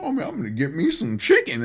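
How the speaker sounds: high, imitated Cajun accent; hammed-up Louisiana Cajun accent. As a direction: imitate a Cajun talking about food. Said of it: "like a Cajun accent".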